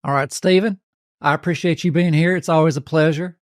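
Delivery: turned-up Southern country twang. Pretend. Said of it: "Let me turn the twang up".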